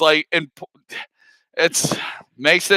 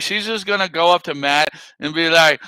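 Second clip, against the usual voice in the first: ordinary delivery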